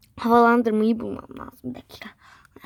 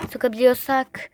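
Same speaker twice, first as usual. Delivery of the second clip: ordinary talk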